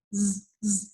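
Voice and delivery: sustained whistling buzz on ز; isolated missing sound. Shown as a demonstration. Teacher showing what the mistake lacks, long buzzing ز. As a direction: buzz ز alone, long and steady. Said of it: "یہ والی sound نہیں آتی".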